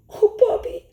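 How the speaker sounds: high, teary wail of confession; crying. A narrator's reading. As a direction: sob out the excuse to Bobby. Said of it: "I cried".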